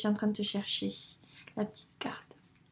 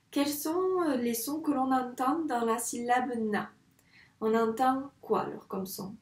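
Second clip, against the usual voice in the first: ordinary talk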